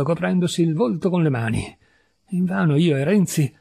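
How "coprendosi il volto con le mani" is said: plain reading voice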